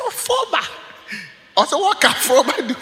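high-pitched mock disbelief; scornful dismissal. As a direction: imitate a friend scoffing at the offer. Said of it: "that's the way he said".

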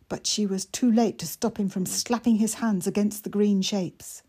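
plain reading voice

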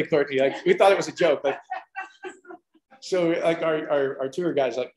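ordinary talk

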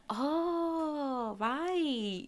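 high, soft, drawn-out "oh, right"; dawning realisation. Retold or imitated. Wife recalling learning he's Mexican, slow realisation. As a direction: let the penny slowly drop. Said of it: "I was like".

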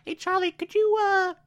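high, brisk sarcastic brush-off; voicing Olivia. Olivia telling Charlie to leave the interrogation, high and quick. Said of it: "Olivia's like".

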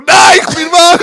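high, very loud yelled reply; shouting. Pretend. Dad answers whispering child at night, shouted. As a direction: yell back in mock panic. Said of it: "Du kannst nur noch schreien die ganze Zeit".

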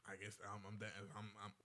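halting and repeating; stumbling over words; stuttering. Real, not acted. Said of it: "I stutter too".

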